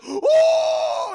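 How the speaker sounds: high, loud startled shriek; startled scream. Retold or imitated. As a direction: jump at the sight in the mirror. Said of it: "She's like".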